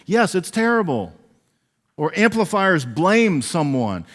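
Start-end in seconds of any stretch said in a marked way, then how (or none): 0.0-1.1 mock gushing agreement, higher; hammed-up eager yes-man; eager agreeing friend. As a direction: play the friend fanning the panic. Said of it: "Amplifiers feed into the anxiety".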